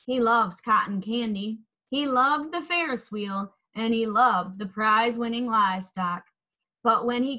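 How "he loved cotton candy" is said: ordinary delivery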